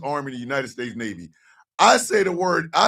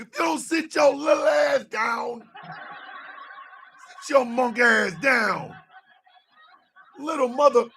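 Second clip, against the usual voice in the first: mock yell; 6 semitones higher than usual. Yelling at short man.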